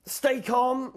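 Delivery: high, loud shout to stay calm. Acted. Owl shouting to calm his scared friends, high and loud. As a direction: shout it over the panic. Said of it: "shouted Owl".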